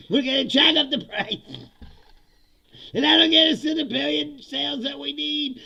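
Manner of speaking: high, loud mock revival pitch; rally the troops with sales targets; hyped company president.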